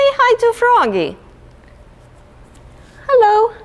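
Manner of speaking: very high, loud little frog voice; squeaky puppet frog. Acted. Teacher voicing a frog puppet greeting the children. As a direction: greet them as a tiny frog.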